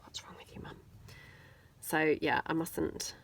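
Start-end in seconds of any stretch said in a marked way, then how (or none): 0.0-0.8 put-on breathy whisper of the kids' line; whispered echo. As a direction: drop to a whisper, repeating it. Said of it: "They would be saying".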